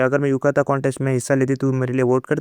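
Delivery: ordinary delivery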